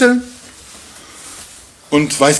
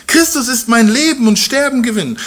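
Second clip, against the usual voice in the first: lightly higher, earnest Paul voice; voicing Paulus; 8 semitones higher than usual. Preacher voicing Paul to the congregation, earnest and higher. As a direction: speak as Paul musing from prison.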